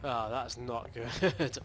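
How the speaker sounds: ordinary talk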